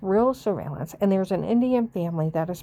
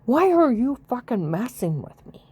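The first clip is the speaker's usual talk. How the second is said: angry outcry; 4 semitones higher, 4 dB louder than usual. Real, not acted. Angry outburst at harassers.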